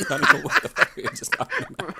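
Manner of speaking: high squeaky laughter through words; cracking up mid-sentence; laughing. Real, not acted. Laughing at own nonsense.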